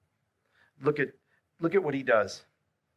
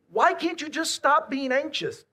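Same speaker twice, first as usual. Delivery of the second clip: high, loud, impatient demand; a lightly exasperated scold; mock exasperated; 11 semitones higher, 5 dB louder than usual. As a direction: nag at an anxious friend. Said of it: "Tell somebody who's anxious".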